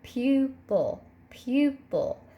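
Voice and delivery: "pupil" slowly split as pu, pil; syllable-break demo. Shown as a demonstration. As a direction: sound out "pupil" in two separate syllables. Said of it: "put your hand under your chin and say it".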